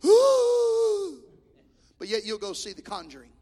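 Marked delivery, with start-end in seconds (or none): 0.1-1.2 high, loud hooting "ooh"; mimicking folks spooked by the preacher, hooting; mock fearful ooh. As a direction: imitate scared churchgoers. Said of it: "And folks go".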